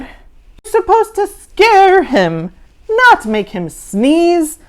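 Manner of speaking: slow, high, loud scolding. Acted. Mister Z scolding Morgan for the sneeze, slow and loud. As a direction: spell out the mistake slowly. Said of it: "Mister Z ordered".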